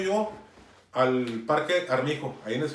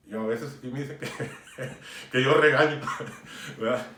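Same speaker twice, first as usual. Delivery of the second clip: chuckling through the words; amused, laughing at his own reputation; 3 semitones lower than usual. Real, not acted. Jokes about his scolding reputation. (entre risas)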